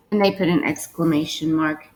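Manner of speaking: ordinary talk, nothing marked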